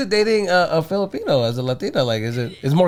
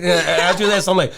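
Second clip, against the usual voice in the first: ordinary talk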